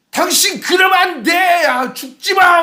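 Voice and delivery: high, slow, loud desperate cry; pleading shout. Retold or imitated. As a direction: grab him and yell don't die. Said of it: "막 이렇게 하던 때 있잖아요".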